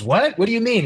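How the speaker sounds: high-pitched, puzzled questioning; puzzled, voice jumping up high; baffled. Acted. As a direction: act out a confused double-take. Acted film scene, Cooper is baffled.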